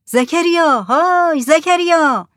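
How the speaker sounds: high, long-range calling voice; loud shout. A narrator's reading. Headman hailing a fisherman at sea, loud shout. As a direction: holler the name out across the water. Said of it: "با صدای بلند داد زد".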